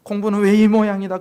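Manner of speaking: 목소리를 높여 꾸짖는 흉내; 고모처럼 크게 나무라듯; 야단치는 고모. Retold or imitated. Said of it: "또 야단치고". (큰 소리로 야단치는 흉내)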